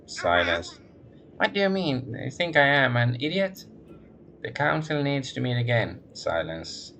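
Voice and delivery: lightly voiced nasal woman, all business; businesslike nasal woman. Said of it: "becomes more businesslike".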